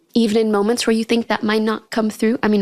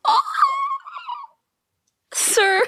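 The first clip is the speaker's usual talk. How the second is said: exasperated, disbelieving sir pitched way up; incredulous. Real, not acted. Reacting to the rude classmate, incredulous and high.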